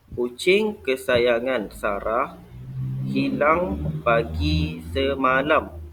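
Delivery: slow model reading with a falling statement tune; careful reading with intonation. Shown as a demonstration. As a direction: read it slowly as a model for the class. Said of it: "ni Ustaz akan bacakan". (bacaan contoh dengan intonasi ayat penyata)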